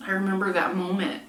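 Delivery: choked up, halting with long pauses; getting emotional. Real, not acted. Apologizing to viewers while remembering giving up, choked up. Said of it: "getting so emotional".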